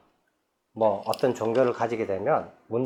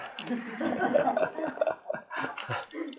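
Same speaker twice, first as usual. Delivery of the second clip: breathy laugh; 9 dB quieter than usual. Real, not acted. Laughs at own harsh remark.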